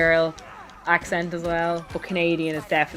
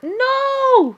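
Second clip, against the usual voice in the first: long high wail of "no"; mock horror; 5 dB louder than usual. Burped in company, hammed-up horror. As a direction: melodramatic drawn-out cry.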